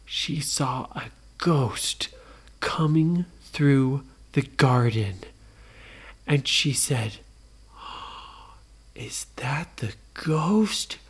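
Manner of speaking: slow, near-whispered storytelling; spooky hush for a ghost sighting; hushed suspense. A narrator's reading. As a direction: draw it out softly with a gasp.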